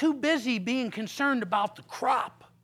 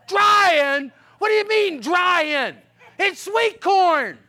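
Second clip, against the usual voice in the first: loud, high, incredulous outburst; can't believe what he hears; preacher aghast at the workers; shocked disbelief; 6 semitones higher, 8 dB louder, slower than usual. Retold or imitated. Pastor shocked at dried corn.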